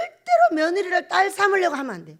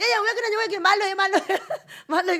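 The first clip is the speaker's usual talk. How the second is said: high, louder mock-panicked plea; flustered pleading; 6 semitones higher, 4 dB louder than usual. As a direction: plead in comic alarm. Pleading with yelling daughter-in-law.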